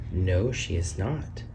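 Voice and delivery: plain reading voice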